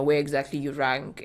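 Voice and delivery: usual speaking voice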